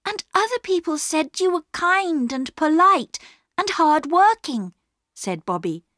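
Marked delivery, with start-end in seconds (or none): 0.0-4.7 put-on high child's voice, urgently explaining; high-pitched, insistent young girl; earnest pleading girl. A narrator's reading. Plays Bobby pleading with Perks.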